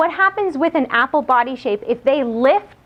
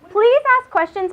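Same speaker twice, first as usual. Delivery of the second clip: eager plea; 10 semitones higher than usual. Real, not acted. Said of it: "urging the audience to ask".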